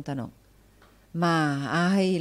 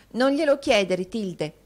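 esclamato; 4 semitones higher, 5 dB louder than usual. Acted. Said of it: "esclamò".

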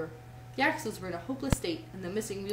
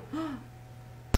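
gasp; 5 semitones higher, 4 dB quieter than usual. Real, not acted. Reader gasps at the crash.

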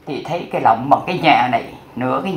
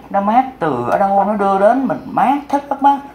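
ordinary delivery

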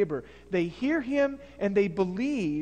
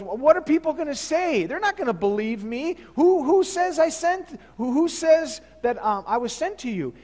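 anxious higher voice, almost pleading; worried Moses; 6 semitones higher, 5 dB louder than usual. Retold or imitated. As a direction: protest nervously, as if you doubt yourself. Moses protests, fearing nobody believes.

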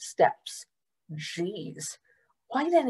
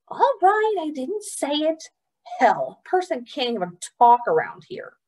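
high, huffy give-in; sulky grumble; huffy Gilly; 7 semitones higher than usual. Acted. Gilly grumbling at Trotter after scolding, huffy.

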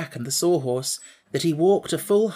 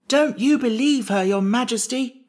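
high, louder protest; urgent cry; 9 semitones higher, 4 dB louder than usual. A narrator's reading. Jack warning the king about Jellia, crying out. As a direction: cry out to warn against a lie. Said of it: "cried Jack".

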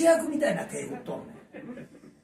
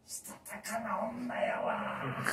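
whispered aside; 5 semitones higher, 4 dB quieter, much slower than usual. Shown as a demonstration.

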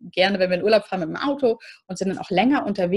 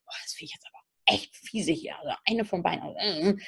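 muttered under the breath, much softer; annoyed inner thought; 2 semitones lower, 8 dB quieter than usual. Retold or imitated. Parent's private irritation at a fight, muttered softly. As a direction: grumble privately to yourself. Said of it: "haben wir manchmal in so einem Moment das Gefühl".

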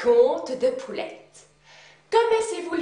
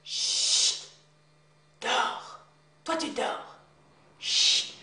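whispering; 5 dB quieter, slower than usual. Acted. Hushing to sleep.

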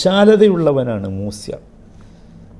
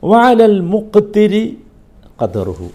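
slow, louder, rising verse chant; Quran recitation; 5 semitones higher, 4 dB louder, slower than usual. Acted. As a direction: stretch the verse like tilawa. Quotes the next verse phrase. (പതുക്കെ ഉറക്കെ പാരായണം)

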